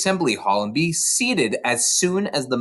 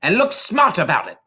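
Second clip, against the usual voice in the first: higher, curt command; shouted; 8 semitones higher, 3 dB louder than usual. A narrator's reading. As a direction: snap the order out. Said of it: "the Trunchbull shouted".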